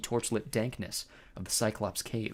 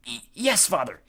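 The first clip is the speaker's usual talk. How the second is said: scared mumble; 4 semitones higher, 4 dB louder than usual. A narrator's reading. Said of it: "Clarice mumbled".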